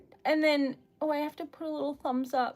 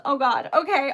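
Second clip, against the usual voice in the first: ordinary delivery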